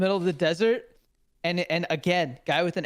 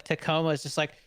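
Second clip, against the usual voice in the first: usual speaking voice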